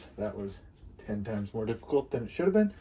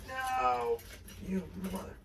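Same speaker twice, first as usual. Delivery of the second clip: exasperated outburst; 13 semitones higher, slower than usual. Real, not acted. Struggling with part.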